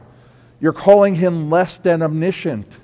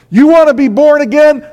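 much higher and louder fiery preaching; full-force preaching; 9 semitones higher, 9 dB louder than usual. Acted. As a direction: drive the warning home to the congregation.

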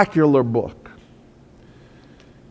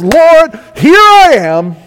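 high, loud preacher's cry; ringing shout of surrender; impassioned answer; 10 semitones higher, 6 dB louder, slower than usual. Acted. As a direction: call out high to God, offering yourself. Isaiah answering God's call, acted.

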